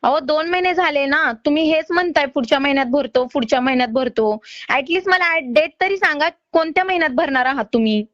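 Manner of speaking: ordinary talk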